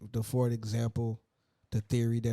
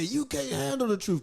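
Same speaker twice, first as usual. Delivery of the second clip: movie quote; 12 semitones higher than usual. Retold or imitated. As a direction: fling the famous line. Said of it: "you know, they say".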